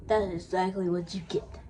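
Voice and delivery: ordinary delivery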